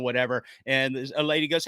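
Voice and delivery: usual speaking voice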